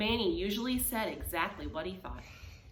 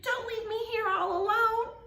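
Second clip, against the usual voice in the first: much higher pleading; higher anxious plea; 13 semitones higher than usual. Acted. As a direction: plead high not to be left. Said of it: "cried Sheep".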